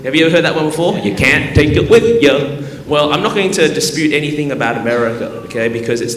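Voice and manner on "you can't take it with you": folksy Southern American saying; South Carolina drawl on the saying; Southern US accent. Retold or imitated. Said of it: "You'll hear this all the time".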